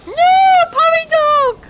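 very high, loud wail; mock-anguished cry. Player crying out for the eaten dog, high wail. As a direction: cry out for a lost puppy.

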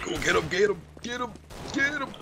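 egging on a teammate, building up; excited urging. Real, not acted. Urging teammate onto last enemy.

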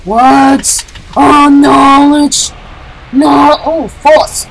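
drawn out very slowly and loud; mock dragon shout. Gamer shouting a spell, slow hammed-up bellow. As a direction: bellow it like a dragon's power word.

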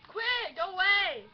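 loud annoyed yell. Real, not acted. Yelling at kids.

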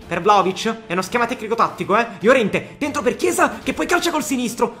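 ordinary delivery

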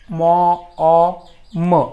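म, अ, म as separate sounds with pauses. Shown as a demonstration. Teacher breaking the word up, म, अ, म one by one. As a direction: spell out म, अ, म by sound. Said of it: "असा उच्चार करतोय".

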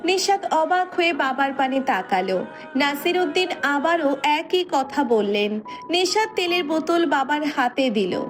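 usual speaking voice

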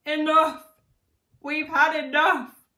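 loud, high cry of surrender; desperate cry. A narrator's reading. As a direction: cry out as a beaten king. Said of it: "cried the fat king".

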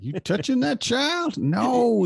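high, slow, accusing busybody voice; a shopper in line accusing the dad, in a shrill mock-horrified voice; scandalized shopper. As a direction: clutch your pearls at the dad.

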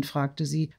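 ordinary delivery, nothing marked

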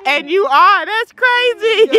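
high, laughing squeals through the words; can barely get it out for laughing; giggling disbelief. Real, not acted. Son jokes about her room.

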